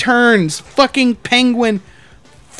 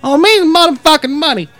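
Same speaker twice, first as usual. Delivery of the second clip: higher-pitched put-on drunk complainer; imitated drunk man; 5 semitones higher than usual. Drunk man cornering the manager about money, higher-pitched impression. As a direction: mimic a drunk old man demanding help. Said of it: "he kept talking like that dude".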